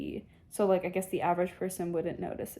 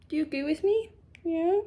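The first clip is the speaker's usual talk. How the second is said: higher, sing-song voice for the cat; pet talk; 7 semitones higher than usual. Real, not acted. Owner asking her cat to agree, sweet pet voice.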